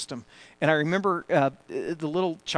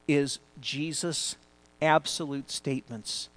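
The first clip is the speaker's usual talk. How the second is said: usual speaking voice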